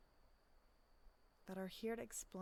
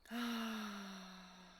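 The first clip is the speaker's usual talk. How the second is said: deep sigh. Shown as a demonstration. Coach leading listeners through a breath, long sigh. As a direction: let the breath out in one slow sigh. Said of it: "Big deep breath".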